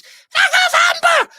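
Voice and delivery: squealed like a horrible surprise bursting out; sudden dramatic cry. Acted. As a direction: burst out in a high, strained screech. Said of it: "it suddenly goes".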